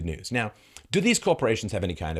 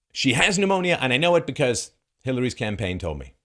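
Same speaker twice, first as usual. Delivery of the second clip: loud, high, emphatic imitation of a reporter; mocking, punched-up proclamation; mock confident assertion; 5 semitones higher, 5 dB louder than usual. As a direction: declare it with overblown certainty. Mimics reporter's confident claim.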